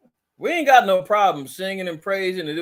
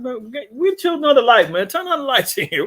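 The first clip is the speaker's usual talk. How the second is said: louder, higher mock protest; acted urging; 5 semitones higher, 5 dB louder than usual. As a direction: call out as if rousing people in the dark. Acting out calling to people in darkness.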